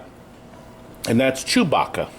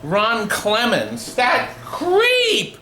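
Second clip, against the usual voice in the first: shrieking high mock outrage; 9 semitones higher, 3 dB louder, much slower than usual. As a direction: squeal it like a scandal.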